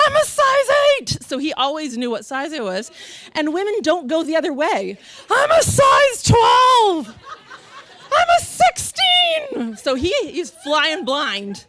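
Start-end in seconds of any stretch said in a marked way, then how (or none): 0.1-1.2 high, loud triumphant shout; yelled announcement. Retold or imitated. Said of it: "I would literally yell out". 5.3-9.8 very high, slow, loud holler; yelled announcement. Retold or imitated. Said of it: "I would literally yell out".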